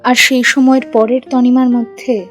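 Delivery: plain reading voice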